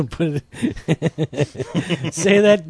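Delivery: words broken up by laughter; laughing. Real, not acted. Cohost teasing the guest about her baskets remark, laughing. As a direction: say it through giggles, stretched out. Joking request through laughter.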